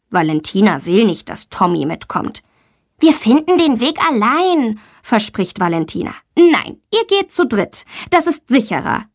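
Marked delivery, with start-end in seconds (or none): 3.0-4.8 put-on high child's voice, promising; assuring schoolgirl. A narrator's reading. Pupil doesn't want Tommy along, high insistent voice. 6.3-9.0 higher-pitched teacher's firm no; firm refusal. A narrator's reading. Teacher overrules Valentina for safety, firm refusal. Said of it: "bestimmt ihre Lehrerin".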